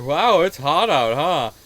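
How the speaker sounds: loud, cheesy small-talk voice; hammed-up small talk. Visitor bugging an outdoor worker with cheesy small talk. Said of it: "decides to be like".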